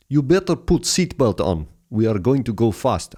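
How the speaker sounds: hammed-up Polish accent, all business; imitated Polish accent. Cab driver warning Vaughan to buckle up, in a Polish accent. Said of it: "He was like the Polish James Bond".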